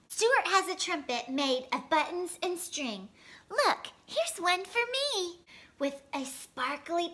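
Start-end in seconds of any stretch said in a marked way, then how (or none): none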